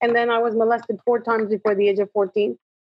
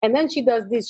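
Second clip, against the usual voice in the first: ordinary talk, nothing marked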